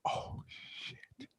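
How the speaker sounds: drawn-out, voiceless whisper of disbelief; stunned whisper. Retold or imitated. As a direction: whisper it slowly, awestruck. Stunned reaction to Dolphins call.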